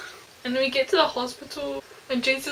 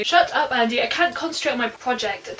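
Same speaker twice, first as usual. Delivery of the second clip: ordinary talk, nothing marked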